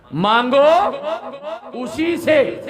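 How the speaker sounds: high loud shout. Real, not acted. Preacher urging crowd to ask God, high shout. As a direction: hurl it out high.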